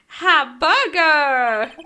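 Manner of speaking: drawn-out loud yell, very slow; long booming announcement of the word; big shout. Acted. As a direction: belt the word like reading a giant sign. Reading a big sign aloud.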